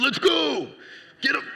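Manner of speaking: high, loud heroic command; superhero voice. Retold or imitated. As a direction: bark it like a comic-book hero. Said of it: "some kind of superhero voice".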